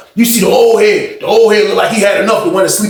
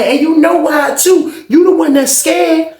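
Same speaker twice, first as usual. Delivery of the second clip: taunting yell; 11 semitones higher, slower than usual. Retold or imitated. The boy repeating his taunt at Sean, high yell. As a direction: drag out the taunt. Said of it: "He kept saying that".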